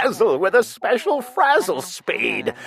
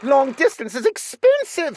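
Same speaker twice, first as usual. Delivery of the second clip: cried out; 7 semitones higher than usual. A narrator's reading. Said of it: "sister cried".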